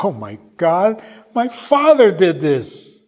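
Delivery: high, loud and slow on the last words; stunned realization. Retold or imitated. The rabbi re-enacts the old man's overwhelming realization, high loud and slow. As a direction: let it dawn, overwhelmed.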